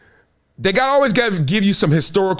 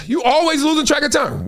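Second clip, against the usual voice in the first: exasperated sigh, then a higher nagging complaint; scolding partner; 7 semitones higher than usual. Retold or imitated. Partner scolding someone who came home late, exasperated.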